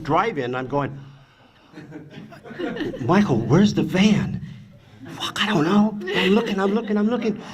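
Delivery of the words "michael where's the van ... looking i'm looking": much higher, frantic retold panic shouting; panicked yelling. Fleeing the angry boyfriend through the drive-in, frantic high-pitched panic. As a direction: yell it while running from a man with a bat. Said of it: "And I'm yelling and screaming".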